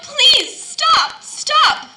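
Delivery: very high, loud choking plea; crying out. Acted. Teacup choking on paint fumes, high loud plea. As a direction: gasp out a plea through the fumes. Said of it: "I tried to cry out".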